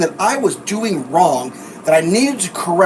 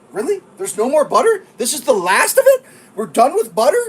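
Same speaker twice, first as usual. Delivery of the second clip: higher-pitched, incredulous questioning; mock disbelief; 6 semitones higher than usual. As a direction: act exaggerated disbelief at the news. Acted disbelief at butter answer.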